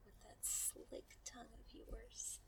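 slow seductive whisper. Acted. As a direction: breathe it slowly in their ear.

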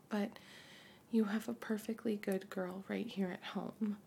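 usual speaking voice

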